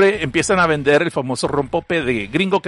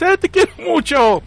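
mock sweet voice; 12 semitones higher, slower than usual. Lee un mensaje cariñoso con voz fingida.

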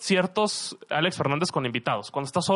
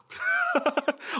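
high squeaky laugh. Real, not acted. Surprised by the shared surname.

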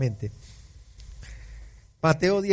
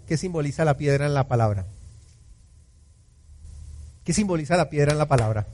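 normal talking voice

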